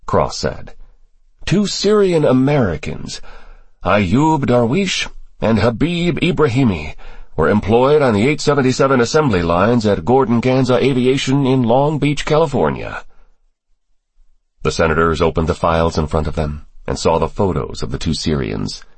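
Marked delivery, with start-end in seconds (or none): 1.4-13.0 higher-pitched, deliberate briefing voice; briefing official. Acted. Official briefing senators on two suspects, higher, measured character voice. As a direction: lay out the case in a higher register.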